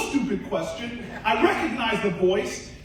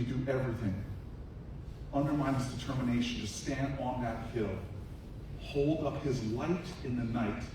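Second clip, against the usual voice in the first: hushed solemn speech; 10 semitones lower, 7 dB quieter than usual. Acted. Senator honoring the old man with the light to the crowd, hushed and solemn. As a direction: bring the room in close and hush it.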